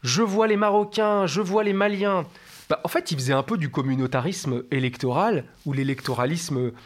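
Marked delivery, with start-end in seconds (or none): 0.0-2.2 hammed-up campaign-speech shout of Emmanuel Macron; ringing stump-speech crowd call, drawn out. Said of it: "quand il criait".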